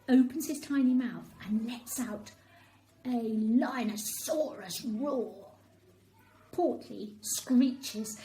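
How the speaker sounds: ordinary delivery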